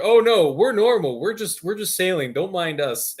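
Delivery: high, hurried-sounding mock reassurance, loud at first; innocent act. Disguised raider signalling to the cruiser, mock innocence. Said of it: "trying to tell the cruiser".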